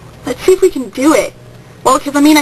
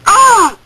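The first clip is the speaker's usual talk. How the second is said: frustrated; 6 semitones higher, 4 dB louder than usual. Real, not acted. Frustrated cry after phone trouble.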